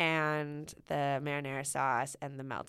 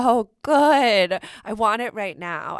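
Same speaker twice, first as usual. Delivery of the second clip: gushing; 5 semitones higher, 15 dB louder, slower than usual. Real, not acted. Gushing about shrimp dish.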